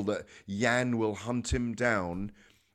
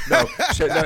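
laughing; 10 semitones higher, 8 dB louder than usual. Real, not acted. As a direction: burst of laughter, high and loud. Laughing at host's joke.

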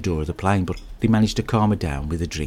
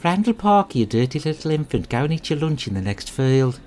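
high, scolding mock teacher voice; imitating an old schoolteacher sending the boy away; Missus Moody scolding; 6 semitones higher than usual. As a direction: pitch it way up like a nagging teacher telling off a pupil. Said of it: "She used to say".

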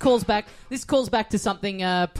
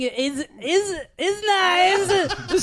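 high mock foreign accent for a catchphrase; Borat; 8 semitones higher, much slower than usual. Signing off the cameo message, Borat. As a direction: climb into a silly accented sign-off. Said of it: "four bad impressions".

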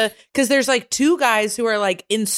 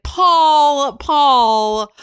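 higher, louder warning call of the name; mom calling out; 8 semitones higher, 6 dB louder than usual. Retold or imitated. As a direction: call him back like a worried wife. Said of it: "My mom's always like".

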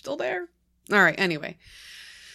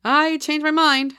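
higher, louder, slow nervous outburst; alarmed blurt; 9 semitones higher, 7 dB louder, slower than usual. Acted. Narrator telling boyfriend she changed her mind, alarmed. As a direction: blurt it out in sudden fear. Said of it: "I said quickly".

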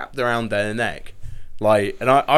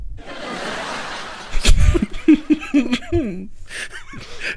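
high laugh. Real, not acted. Laughing at own joke.

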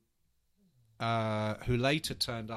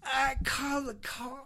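slowish, much higher Scottish accent imitation; 11 semitones higher, slower than usual. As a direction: play the Scottish flatmate urging you. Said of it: "I can't do the accent".

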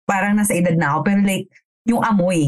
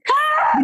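sudden high startled shriek; a shriek on catching her; startled scream. Retold or imitated. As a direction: let out a yelp of surprise. Caught naked by roommate.

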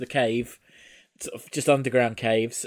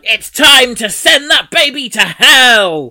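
slow, loud evil villain yell; evil villain shout; 9 semitones higher, 13 dB louder, slower than usual. Retold or imitated. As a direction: roar it like a cackling witch. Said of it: "she's like".

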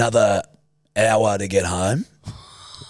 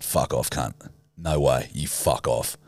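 much lower and softer, dead firm no; low flat refusal; 8 semitones lower, 5 dB quieter, slower than usual. Retold or imitated. Refusing the tuk-tuk driver's extra charge, low and firm. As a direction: shut the scam down cold.

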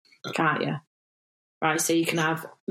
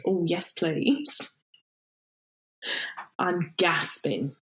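slow relieved acceptance; much slower than usual. Shown as a demonstration. Demo of ways to accept tea.

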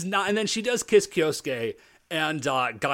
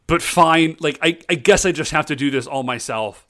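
much louder, a mock-menacing declaration; villain getting worked up; 7 dB louder than usual. Emperor Exhaust vows to handle the Rangers himself, loudly. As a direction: bellow it like a cartoon villain.

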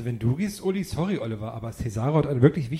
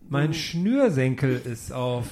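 slow repeat; slower than usual. Acted.

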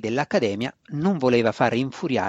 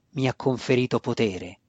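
usual speaking voice

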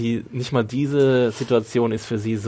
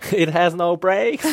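acted movie quote; 5 semitones higher, 4 dB louder, much slower than usual. Quoting the car scene in English.

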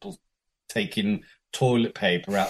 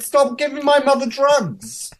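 high-pitched, louder mock telling-off; retold outrage; 5 dB louder than usual. Woman scolding speaker over her mother's joint, mock outrage.

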